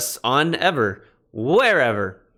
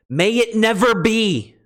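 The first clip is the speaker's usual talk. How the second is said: higher and slow, every word weighted; emphatic; 9 semitones higher, 4 dB louder than usual. Shown as a demonstration. Teacher showing how strong the Greek negation is, slow and high. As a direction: proclaim it slowly, with force. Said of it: "It's used for emphasis".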